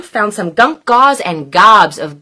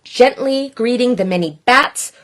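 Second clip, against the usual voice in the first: plain reading voice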